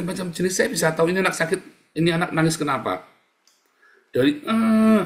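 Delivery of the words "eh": put-on high, drawn-out baby wail; sustained mock whine, raised pitch; imitated fussy crying. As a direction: mimic a child crying in annoyance. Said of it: "nangis sampai kesel begitu".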